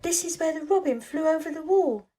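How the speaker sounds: ordinary delivery, nothing marked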